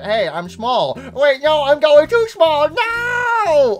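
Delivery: builds from plain to loud high scream; shrinking mimic panic. Gamer voicing Mimic shrinking too small, rising into a high scream. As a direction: start chirpy, then panic as you shrink. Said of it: "So he's like".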